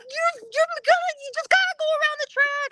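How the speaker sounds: high, hurried squeal of directions; excited urging. Retold or imitated. Friend Kim sending her round the track, excited high urging.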